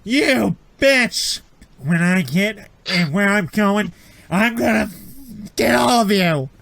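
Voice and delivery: high, loud acted rage through pain; agonized angry threat. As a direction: yell threats like a wounded victim. Injured victim threatens his attackers.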